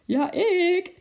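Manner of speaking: very high, loud drawn-out call; playful call from afar; lachend roepen. A narrator's reading. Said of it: "riep de buurman lachend uit de verte". (hoog, plagend uit de verte geroepen)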